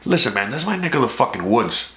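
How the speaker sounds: ordinary talk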